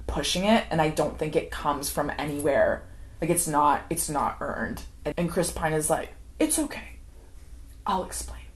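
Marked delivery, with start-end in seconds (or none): 6.4-8.4 soft, near-whispered mock reassurance; quietly smooth things over; hushed placating. Acting out man placating upset woman.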